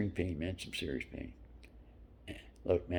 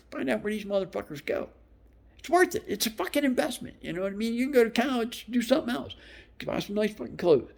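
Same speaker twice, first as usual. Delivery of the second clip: much higher and louder, pushing hard; fired-up fatherly advice; 13 semitones higher, 4 dB louder than usual. Retold or imitated. Father urging his daughters how to find rich men, fired up and much higher. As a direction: sell it to them like a coach. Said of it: "so I, I say".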